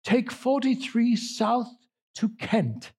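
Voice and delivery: high, slow British navigation voice; British accent. Retold or imitated. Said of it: "She'll say".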